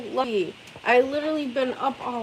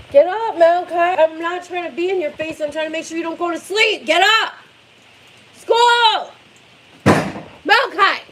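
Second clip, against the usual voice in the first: loud, high yelling; 6 semitones higher, 4 dB louder than usual. Real, not acted. Mom yelling at her son to get to school. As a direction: shout him awake, exasperated.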